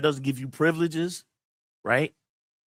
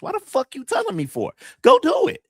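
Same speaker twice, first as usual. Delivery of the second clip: angry retort; 5 dB louder than usual. Retold or imitated. Angry reply to a would-be rival.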